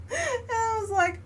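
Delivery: high wheezing laugh running into the words; laughing hard. Real, not acted. Retelling the cat story to viewers, laughing hard. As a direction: crack up helplessly.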